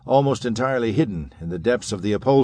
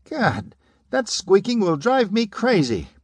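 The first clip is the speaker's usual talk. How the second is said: much higher and slow, nerves fraying; high-pitched whine; 10 semitones higher than usual. A narrator's reading. Pudgy man complaining about the squeaking shoes, high-pitched and slow. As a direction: whine it like a man at breaking point. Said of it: "high-pitched voice".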